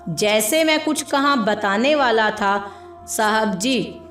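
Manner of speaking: usual speaking voice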